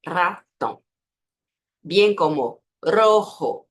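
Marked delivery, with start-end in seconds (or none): 0.1-0.8 Ratón in two drawn-out syllables, R trilled; strong Spanish R. Shown as a demonstration. Teacher asking learners to repeat, trilled R. 2.8-3.7 rojo with rolled R, throaty j; vibrating R. Shown as a demonstration. Teacher showing the harder word, vibrating R. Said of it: "um som mais vibrante".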